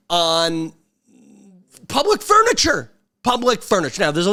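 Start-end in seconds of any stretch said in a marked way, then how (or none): 1.9-4.0 outraged. Acted. Outraged emphasis on phrase.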